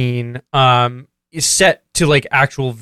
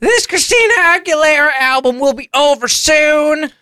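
put-on much higher dopey man-child voice; Ralph voice; 9 semitones higher than usual. [name] as Ralph, brushing off his dad in a high dopey voice. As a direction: whine it like a clueless slacker. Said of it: "my Ralph voice".

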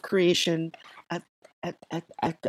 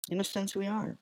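"in a sense, we are" repeated much softer, almost to himself; quiet repeat; 8 dB quieter, faster than usual. Shown as a demonstration. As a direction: drop the repeat to a hush.

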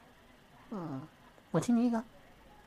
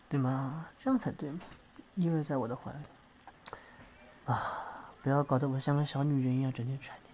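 lower, soft, cool bossy voice; low, unhurried talk, a hint of a romance-novel CEO; domineering partner; 7 semitones lower, 3 dB quieter than usual. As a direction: play the aloof one who holds all the power.

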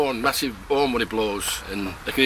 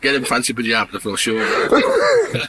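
laughing through punchline; 2 semitones higher than usual. Real, not acted. Farmer repeating his punchline to the walker, laughing.

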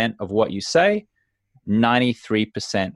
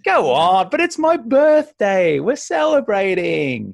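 much higher, slow, wheedling push to drink; pushy host; 9 semitones higher, 3 dB louder than usual. Retold or imitated. Host pressing a guest to drink, high wheedling coax. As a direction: coax them like an insistent party host. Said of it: "the host is gonna be like".

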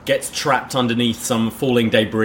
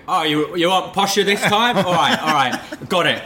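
raised Cockney actor voice; Michael Caine; 6 semitones higher than usual. Retold or imitated. Actor answering his director's note, mock Cockney. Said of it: "Yeah, Sir Michael".

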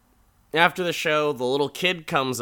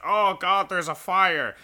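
alarmed; 7 semitones higher, 3 dB louder than usual. Retold or imitated. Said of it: "everyone's just like".

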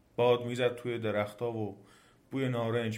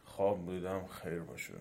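low, soft, fading to sleep; drowsy demon; 4 semitones lower, 7 dB quieter than usual. A narrator's reading. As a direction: let it sink away as you doze off. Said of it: "و باز گرفت خوابید".

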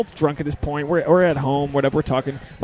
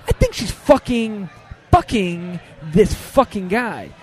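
slow, higher, seething retold outburst; angry; 4 semitones higher, slower than usual. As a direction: stretch out each curse as anger builds. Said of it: "starting to get, like, angry".

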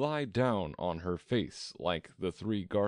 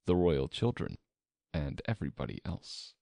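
ordinary delivery, nothing marked

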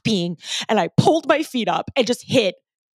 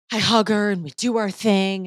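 ordinary delivery

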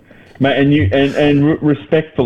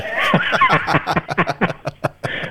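laughing; 9 semitones higher, 4 dB quieter than usual. Real, not acted. Laughing after impression.

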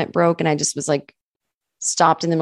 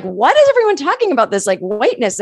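much higher, confused; baffled retort; 8 semitones higher, 4 dB louder than usual. Retold or imitated. Waitress baffled by the talk of whiteness, much higher. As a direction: sound totally lost. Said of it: "And I was like".

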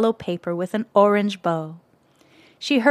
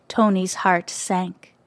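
usual speaking voice

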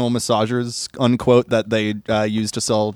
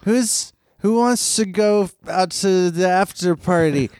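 put-on getting drunker; 7 semitones higher, slower than usual. Voicing drunk Wobbles.

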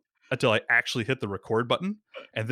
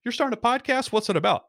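bright, much higher mock enthusiasm; curious friend; 12 semitones higher than usual. Friend in elevator reacting to podcast pitch, high and eager. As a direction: play the friend perking up, voice up high. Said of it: "Dustin's like".

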